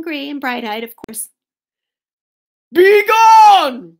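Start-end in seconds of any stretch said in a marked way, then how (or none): 2.7-4.0 loud, high-pitched bear roar; full-force angry shout. A narrator's reading. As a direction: roar the order, drawing it out. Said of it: "roared Bear".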